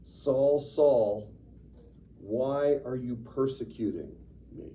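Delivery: mild dramatic divine call. Retold or imitated. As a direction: call his name from heaven. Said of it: "but he says".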